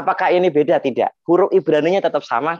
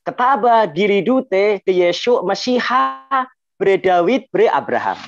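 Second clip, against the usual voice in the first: bacaan Aram Madenoyo yang lambat, vokal A terbuka; dialek Madenoyo; 3 semitones higher, slower than usual. Shown as a demonstration. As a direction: bacakan pelan gaya Madenoyo, "Mesiha", "Abraham". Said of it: "menurut dialek Madenoyo gini". (dialek Madenoyo, bervokal A)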